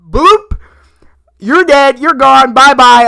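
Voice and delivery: mocking loud goodbye to the father; gleeful kill-line shout. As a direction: shout it up high like a gleeful execution. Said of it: "I love when Peacemaker went".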